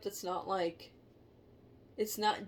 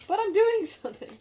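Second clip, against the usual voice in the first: high, loud exclaim; loud protest; 14 semitones higher, 13 dB louder than usual. Real, not acted. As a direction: burst out in protest. Protesting, laughing.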